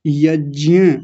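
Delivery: यज्ञ said as "yajyen", with j and y blended; correct pronunciation. Shown as a demonstration. Teacher gives the right way to say यज्ञ. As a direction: model यज्ञ slowly as yaj-ya. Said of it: "और कैसे बोला जाएगा?".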